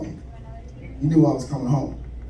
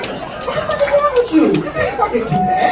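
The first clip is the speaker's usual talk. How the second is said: pitched way up, indignant outcry; outraged squeal. Acted. Comic to the crowd, outraged the intruder played his game save, squealing high. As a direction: squeal your outrage at him.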